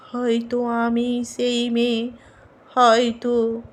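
soft, lingering close to a poem; hushed ending. Acted. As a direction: let it sink to a quiet, tentative finish.

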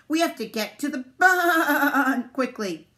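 "barn" stretched into a long, loud baa; high, slow sheep bleat on "barn". A narrator's reading. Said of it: "See how he said barn? 'Cause they're sheep".

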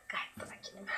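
whispered, low and hushed; a sarcastic hushed whisper claiming calm; mock calm whisper. Shown as a demonstration. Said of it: "Gayet sakin değil".

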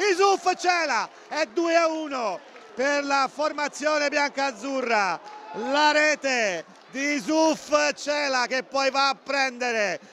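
screaming goal call, then stretched slow; breathless Italian goal commentary. Real, not acted. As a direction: erupt high and hold every vowel. Goal scored, commentator erupts.

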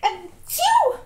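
loud sudden sneeze; real sneeze bursting out; sneezing. Real, not acted.

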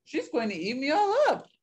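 high, swooping mock wail; panicked cry. A narrator's reading. The bear flees from Dot, crying out in panic. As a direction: wail it up to a squeal. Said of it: "cried the bear".

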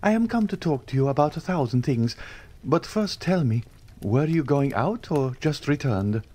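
put-on a pushy young man inviting himself along; lightly voiced Andrea, eager; eager young dandy. A narrator's reading.

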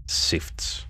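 eerie whisper. Acted. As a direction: breathe the word. Ghostly word.

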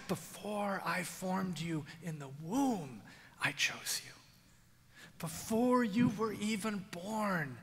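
hushed, unvoiced, slow; whispered first telling. Preacher whispering God's words to the congregation. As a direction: breathe it like a secret.

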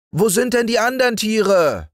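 put-on high little boy's voice, calling upward; child calling up. Little Häwelmann calling up to the rooster, high child voice. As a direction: call up to the rooster like an eager small boy. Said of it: "rief der kleine Häwelmann hinauf".

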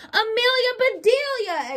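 an employer crying out at her maid; exasperated exclamation. A narrator's reading. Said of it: "exclaimed Missus Rogers".

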